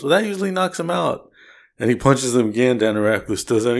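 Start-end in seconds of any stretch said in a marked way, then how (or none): 0.0-1.3 higher, mildly puzzled henchman; a touch of thug confusion; lightly voiced henchman, surprised it failed. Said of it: "And he goes".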